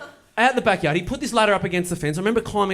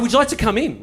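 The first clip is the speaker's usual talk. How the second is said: gleeful, bright invitation, pitch up; chirpy welcome to a stranger at the door; with a bit of glee; 8 semitones higher, 4 dB louder, faster than usual. Retold or imitated. To the confused neighbour, a gleeful would-you-like-to-come-in. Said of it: "with a bit of glee like that".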